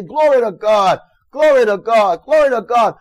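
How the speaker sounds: loud, rising, repeated glory to God; mock-jubilant churchgoer praise; exultant praise. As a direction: shout praise as you walk away. Believers exulting after prophecy.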